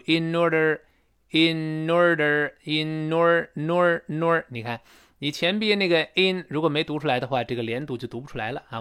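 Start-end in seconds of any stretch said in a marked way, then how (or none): 0.0-4.4 slow "In order" run together as in-nor-der; linked n. Shown as a demonstration. Teacher drilling linking, n joined onto ORDER. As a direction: carry the n into "order", giving nor. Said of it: "必然出现前鼻音连读".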